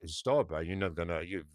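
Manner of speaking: low, soft, quick words of caution; cautioning friend. Retold or imitated. Friend warning the Italian about sugar, low-key caution. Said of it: "is saying to his friend".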